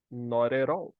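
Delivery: Not at all with t said like a quick r, noray-all; Not at all with flapped t's; Not at all run together, t like r. Shown as a demonstration. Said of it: "O T fica com som de R".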